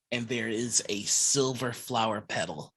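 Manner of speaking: normal talking voice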